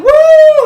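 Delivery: very loud, high-pitched "woo"; Peter's celebrating whoop. Retold or imitated. Speaker imitating Peter going over the first loop, wild whoop. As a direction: whoop at full voice like a rider on a loop. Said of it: "going nuts and celebrating".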